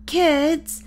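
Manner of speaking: high calling holler across the island; shouting their names from outside. Acted. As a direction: call out for the lost kids. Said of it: "A voice hollers".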